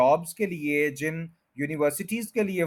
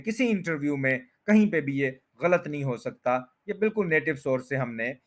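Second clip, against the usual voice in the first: ordinary talk, nothing marked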